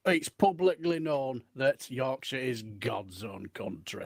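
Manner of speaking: deep, slow proclamation; slow and low. Acted. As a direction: intone it slowly and deep. Yorkshire pride claim.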